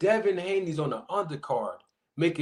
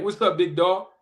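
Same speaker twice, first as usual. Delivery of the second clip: normal talking voice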